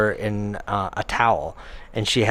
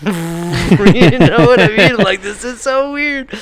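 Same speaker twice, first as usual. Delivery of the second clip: laughing; 9 semitones higher, 9 dB louder, slower than usual. Real, not acted. Laughing at the awkward memory.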